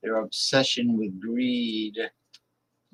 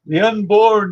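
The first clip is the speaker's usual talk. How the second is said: high, loud mock cry; loud mock plea for the unborn; mocking old zealot; 9 semitones higher, 8 dB louder than usual. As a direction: ham up the pious old extremist. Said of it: "he used to say".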